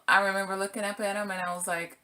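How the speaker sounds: ordinary talk, nothing marked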